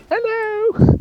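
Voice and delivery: very high, loud British lady falsetto; British woman's voice. Shown as a demonstration. Showing off a British woman's voice, high and loud. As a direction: sing out as a put-on British woman. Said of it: "a better British woman's voice".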